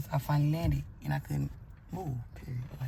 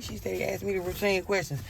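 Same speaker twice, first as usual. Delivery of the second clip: usual speaking voice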